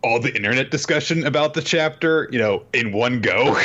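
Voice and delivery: ordinary talk, nothing marked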